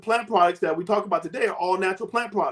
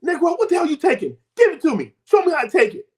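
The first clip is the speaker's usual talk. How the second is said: wife demanding; 10 semitones higher than usual. Retold or imitated. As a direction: insist like a wife who wants it now. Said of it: "My wife goes".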